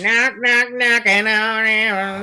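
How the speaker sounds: high sing-song chant. Acted. Sing-song knock knock bit.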